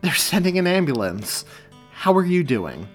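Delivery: chipper, reassuring delivery; bright. A narrator's reading. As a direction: keep it upbeat so nobody panics. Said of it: "he began brightly".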